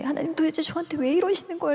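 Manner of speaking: high pleading question, not fully shouted; retold yelling at God. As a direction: protest to heaven in a contained mock yell. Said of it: "소리를 막 지르고".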